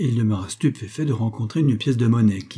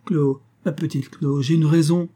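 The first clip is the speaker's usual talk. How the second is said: higher-pitched, halting appeal; stammered plea; 5 semitones higher than usual. A narrator's reading. Man stammering to his lover as she leaves, halting plea. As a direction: plead haltingly, voice raised in pitch. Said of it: "Il bégaya".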